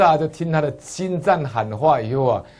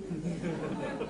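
a quick, much softer chuckle; soft laugh; 4 semitones higher, 15 dB quieter than usual. Real, not acted. Preacher chuckles softly at the son-in-law's question. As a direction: laugh quietly to yourself.

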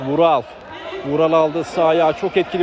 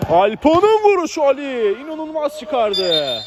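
excited commentary; 8 semitones higher, slower than usual. Real, not acted. Big save in commentary.

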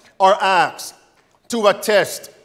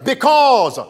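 rising louder to the end; building preacher shout; 3 semitones higher, 5 dB louder than usual. Real, not acted.

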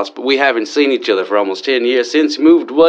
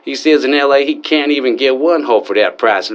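ordinary talk, nothing marked

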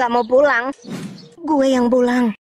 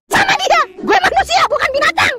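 high, loud indignant animal voice; shrill outrage at being called an animal; indignant shout; 4 dB louder than usual. Acted. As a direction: squeal it out like an offended beast. Animal character protests indignantly.

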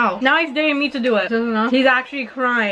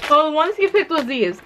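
ordinary talk, nothing marked

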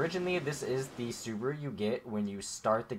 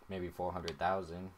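ordinary talk, nothing marked

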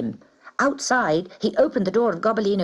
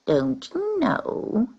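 sneering; 8 semitones higher, much slower than usual. A narrator's reading. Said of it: "she sneered".

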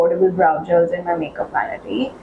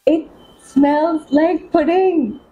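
high, drawn-out squeal of amazement; slow, high-pitched delighted disbelief; amazed delight; 7 semitones higher, much slower than usual. Real, not acted. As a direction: stretch every word out, high and thrilled. Amazed reaction after sniffing.

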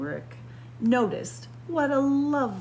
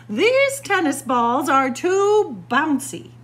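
fussy princess complaint; 6 semitones higher, 8 dB louder than usual. Acted.

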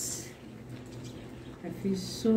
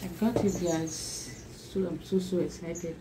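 normal talking voice

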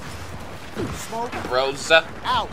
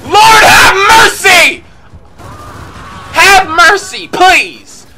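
desperate pleading shout; 11 semitones higher, 8 dB louder, much slower than usual. Real, not acted. Desperate fight in game.

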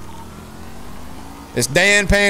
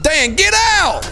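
calling out; 9 semitones higher, slower than usual. Real, not acted. Calling friend from car.

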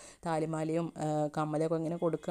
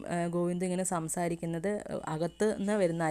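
ordinary delivery

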